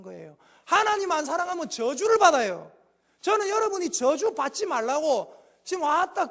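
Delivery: loud, driving sermon voice; fiery Korean pastor preaching; preaching at full force. Acted. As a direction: preach it hard to the congregation.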